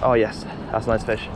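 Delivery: normal talking voice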